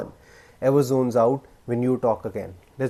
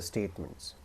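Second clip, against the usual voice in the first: much lower and softer on "statements"; lowered ending; 6 semitones lower, 14 dB quieter than usual. Shown as a demonstration. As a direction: let "statements" fall away, final and quiet. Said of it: "lower your tone slightly".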